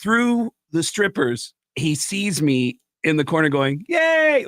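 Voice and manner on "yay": high, loud mock cheer. Comedian cheering Gene from the corner, high whoop. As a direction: whoop like a fan.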